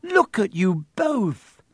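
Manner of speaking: put-on girl scolding the tangled boys; exasperated. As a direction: scold them both in alarm. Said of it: "shrieked Hermione".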